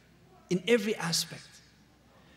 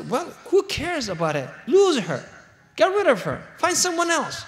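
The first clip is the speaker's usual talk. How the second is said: rising, louder brush-off, each line higher; dismissive husband; 7 semitones higher, 3 dB louder than usual. Retold or imitated. Husband telling his crying wife to drop her friend, loud and dismissive. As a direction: wave the problem away, getting louder. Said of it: "The man would say".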